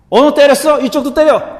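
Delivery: high, loud goading; comically acted indignant challenge; mock challenge. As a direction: dare him to hit you. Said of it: "그리고 뺨을 내밀지 않았습니다".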